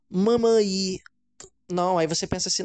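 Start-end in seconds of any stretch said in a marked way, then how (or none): 0.1-1.0 "Mamaí." sounded out slowly, stress on the final í; child's mispronunciation. Shown as a demonstration. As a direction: mimic a small child saying "Mamaí.". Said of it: "pode escutar de uma forma estranha".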